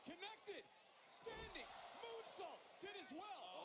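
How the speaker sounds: high, rapid-fire call; excited play-by-play. Real, not acted. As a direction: call each move as it lands. Play-by-play of a flurry.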